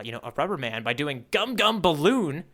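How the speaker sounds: ordinary delivery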